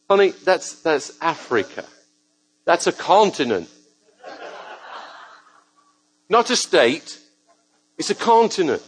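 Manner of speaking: mock patient correction. To the shop girl who called Africa Texas, teasing correction.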